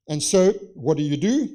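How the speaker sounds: ordinary delivery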